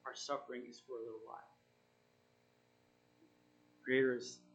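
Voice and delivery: usual speaking voice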